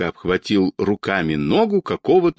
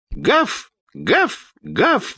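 high-pitched little barks; dog barking; 13 semitones higher, much slower than usual. A narrator's reading. Earless dog joining the commotion, barking. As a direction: bark the word three times, very high. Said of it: "лаяла безухая безглазая собака".

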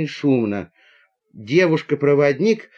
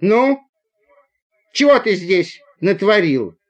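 raised, scolding question; stern demand; 5 semitones higher, 4 dB louder than usual. Acted. Conductor staring at guilty Borya, stern demand. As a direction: demand it high and a little loud.

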